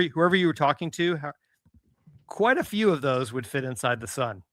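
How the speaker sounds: ordinary delivery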